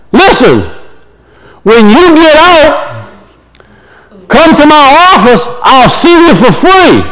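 play-acted helpful hail from above; acted calling down. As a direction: pitch it down into a pit, without shouting. Doctor calls offer into pit.

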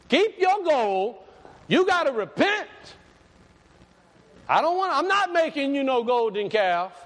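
high-pitched prophet's cry; forceful preaching refusal. Acted. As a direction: push every phrase out high and insistent. Acts John refusing gold, demanding repentance.

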